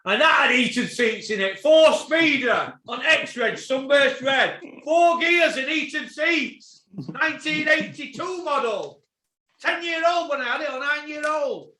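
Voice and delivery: high, loud and drawn out; incredulous rant. Real, not acted. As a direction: call out each item in outraged disbelief. Incredulous rant about heated seats.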